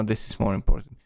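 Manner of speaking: ordinary delivery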